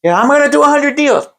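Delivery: high-pitched hyped-up boast; exaggerated, high rah-rah promise; mock affirmation. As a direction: parody someone pumping themselves up. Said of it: "Affirmations are".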